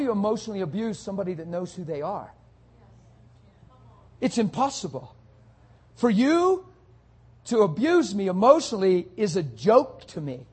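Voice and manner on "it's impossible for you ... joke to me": loud, high-pitched preacher's challenge; forceful preaching. Real, not acted. Preacher scoffing at emotional abuse, forceful and loud. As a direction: hammer it out to the congregation, loud.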